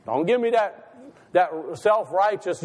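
usual speaking voice

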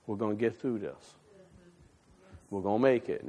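gentle and low, almost hushed; soft reassuring voice. Retold or imitated. A soft voice comforting a worried worker. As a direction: reassure them quietly, like God speaking. The voice God speaks in.

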